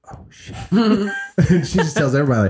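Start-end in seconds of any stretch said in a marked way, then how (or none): 0.1-0.6 very soft retold "uh-oh" moment; imitated private panic, barely audible; hushed dread. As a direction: say it under your breath.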